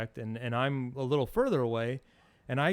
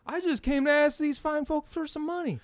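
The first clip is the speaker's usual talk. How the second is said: smart-ass reply; 12 semitones higher, 3 dB louder than usual. Retold or imitated. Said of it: "I just decided to be a smart ass".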